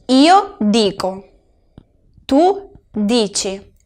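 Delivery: slow, with dici said as DEE-chee; careful pronunciation. Shown as a demonstration. Teacher modelling dico and dici, slowly and clearly. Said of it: "fijaos bien en la pronunciación".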